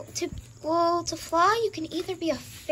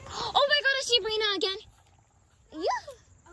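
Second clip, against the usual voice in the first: alarmed; 8 semitones higher than usual. Pretend. Spotting the hunter again.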